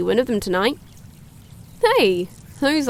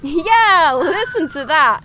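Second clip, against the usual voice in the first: high, loud exclamation; excited; 6 semitones higher, 6 dB louder, slower than usual. Acted. Streamer hears foxes screaming in the woods, excited. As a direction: burst out at a sudden sound.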